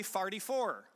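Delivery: forty-four said as farty-four; St. Louis pronunciation. Retold or imitated. Giving highway directions as a St. Louis local, St. Louis pronunciation. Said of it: "And they say".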